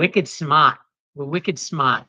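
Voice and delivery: put-on Boston accent with the r dropped in smart; a playful local Boston brag; a Boston accent, half joking. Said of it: "Boston accent?".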